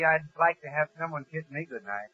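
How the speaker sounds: usual speaking voice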